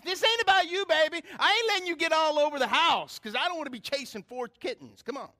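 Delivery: high, loud sassy scolding; mother cat scolding. Retold or imitated. Pastor voices the mother cat scolding her kittens, high sassy voice. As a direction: scold the kittens like a no-nonsense mama. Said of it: "she's looking at her babies".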